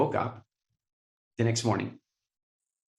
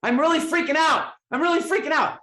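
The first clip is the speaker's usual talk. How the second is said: high, loud mock panic; hammed-up freak-out to a friend; imitating panicked Wayne; 14 semitones higher, 5 dB louder than usual. Wayne panicking to Deepak, high and loud. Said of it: "And he goes".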